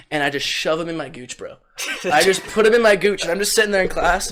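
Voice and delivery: normal talking voice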